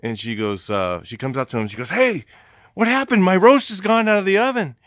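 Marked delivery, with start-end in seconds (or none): 1.9-4.8 high, loud imitation of an upset lady; hammed-up shocked homeowner; mock indignant outcry. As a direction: cry out in exaggerated alarm at a loss. Lady finds her roast gone.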